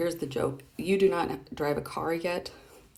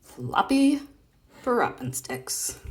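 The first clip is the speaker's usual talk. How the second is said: drawn out; 7 semitones higher, slower than usual. Shown as a demonstration. Showing her son how to take time to say the name, drawn out. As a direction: stretch out Floppy Proppensticks lovingly. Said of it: "you can take a lot of time to say".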